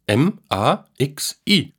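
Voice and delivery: slow, letter-by-letter spelling, then counting. A narrator's reading. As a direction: spell it out slowly, each letter set apart. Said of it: "buchstabierte der Junge". (langsam buchstabierend)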